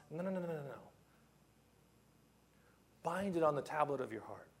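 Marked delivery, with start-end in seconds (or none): none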